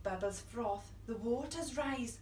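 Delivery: usual speaking voice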